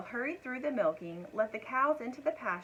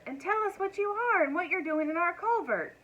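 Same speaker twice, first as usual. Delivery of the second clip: high, gentle cow voice waking someone; cows' voice; 9 semitones higher, 5 dB louder than usual. A narrator's reading. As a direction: nudge the sleeper awake, friendly. Cows question the dragon.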